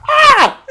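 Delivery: high playful yell; deliberate loud scream; shout. Real, not acted. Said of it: "I'm doing this on purpose".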